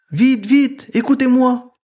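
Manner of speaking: high, loud calling out to his brothers; little frog yelling to his brothers; excited shout. A narrator's reading. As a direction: shout the news, bursting with excitement. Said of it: "leur crie-t-il".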